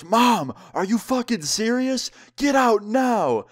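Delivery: high, incredulous outburst at mom, slowing on the final order; outraged mock yell. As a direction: act out a son at breaking point. Acted outraged son throwing mom out.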